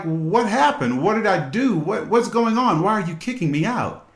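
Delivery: husband caught out, asking his wife why she's throwing him out, high and panicked; confused nervous man. Retold or imitated. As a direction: play the scared, bewildered husband pleading. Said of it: "He was like".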